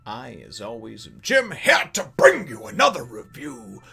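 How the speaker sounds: drawn-out, high-pitched host greeting; hyped show intro. Acted. Host welcoming the Nerd Nation to his review, hyped up. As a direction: stretch every phrase like an excited announcer.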